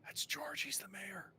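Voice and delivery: pointed whisper meant to be overheard; stage whisper aside; under her breath. Acted. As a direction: hiss it so they can still hear. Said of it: "under her breath, but she makes sure you can hear it".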